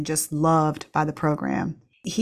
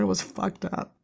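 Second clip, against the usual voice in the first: quiet; 2 semitones higher, 6 dB quieter than usual. Real, not acted. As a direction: let "It was fucked up" sink quietly. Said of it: "excuse my language".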